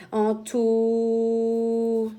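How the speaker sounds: "En tau" stretched, each syllable held; sounding out syllables. Shown as a demonstration. Teacher blending sounds for the class, drawn-out phonics. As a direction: draw out "En tau" slowly, syllable by syllable. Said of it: "Ah, je donne la main".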